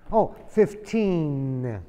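"Oh, fifteen" drawn out slowly; slow corrected fifteen. Shown as a demonstration. Teacher correcting the misheard age slowly. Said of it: "La N".

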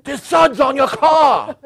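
high, loud, stretched-out holler; yelling. Acted. Other drivers yelling at a man in a soapy car. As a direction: holler it slowly across traffic. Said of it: "They're yelling at me".

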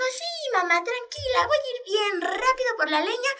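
very high chick voice, trying to sound calm; nervous little chick. Acted. Chick reassures his mother before going out, high and nervous. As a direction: squeak it out, nervous but brave.

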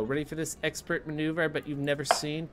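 ordinary talk

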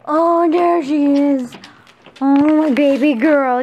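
pet cooing. Real, not acted. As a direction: gush over a beloved pet. Coos over a pet she finds.

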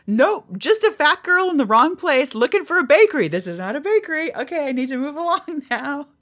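high-pitched, loud mock excuse; acting out an awkward getaway, pitched high; flustered excuse. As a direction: babble a hammed-up excuse up high. Imagined excuse to escape trainer.

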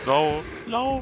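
urgent shout to run; panicked call to flee; being chased. Real, not acted. Fleeing the enraged monster.

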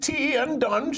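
put-on high, slow posh English accent. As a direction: lay on a plummy English accent. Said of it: "sounds like it should be in England".

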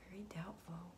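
whispered verdict; soft whispered reading. Real, not acted. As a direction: breathe the answer out. Reading spirit's answer.

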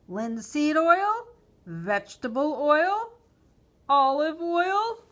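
high, loud, drawn-out options; slow, exasperated shopkeeper questions; impatient questioning. A narrator's reading. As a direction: list each choice testily, rising each time. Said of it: "With a frown of impatience".

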